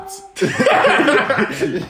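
falling high-pitched giggle; long, high, amused laugh. Real, not acted. Laughing at friend's question.

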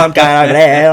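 excited. Retold or imitated. Eager to get dressed and go.

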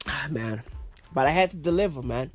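stuffy, congested voice, lying in bed; blocked nose. Real, not acted. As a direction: talk through a blocked nose. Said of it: "I'm fucking blocked up".

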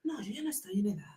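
whispered aside, unvoiced. Real, not acted. Joking to her friend about her age, whispered. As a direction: breathe it as a private joke.

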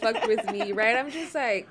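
ordinary talk

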